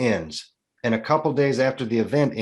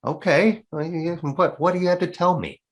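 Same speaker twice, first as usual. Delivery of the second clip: eager curiosity; 6 semitones higher than usual. Retold or imitated. Eager to hear the secret.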